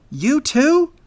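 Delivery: high, loud indignant question; exasperated. A narrator's reading. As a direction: shoot up in pitch, offended. Squirrel reacts to being doubted.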